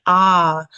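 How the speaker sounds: "ah" held long and loud; long vowel. Shown as a demonstration. Teacher showing students a long vowel, drawn out. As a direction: draw out "ah" as one sustained vowel. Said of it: "the vowel is a long sound".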